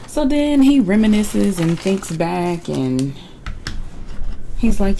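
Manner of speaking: ordinary talk, nothing marked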